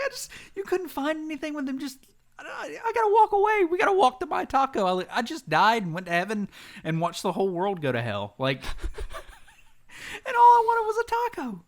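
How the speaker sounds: mock wail. Retells his own overwrought reaction.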